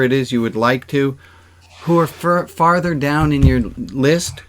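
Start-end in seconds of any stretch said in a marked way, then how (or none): none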